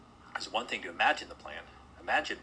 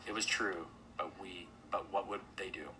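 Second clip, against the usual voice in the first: ordinary delivery, nothing marked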